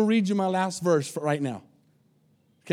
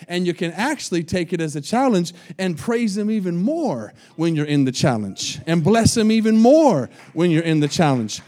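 ordinary talk, nothing marked

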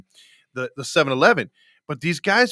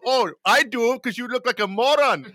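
higher-pitched taunt in put-on Indian accent; mock Indian accent; 7 semitones higher than usual. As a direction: imitate an Indian-accented clerk teasing a customer. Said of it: "the Indian one was from when I was in high school".

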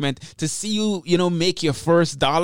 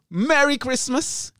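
cheery greeting; 10 semitones higher than usual. Real, not acted. Holiday farewell to listeners.

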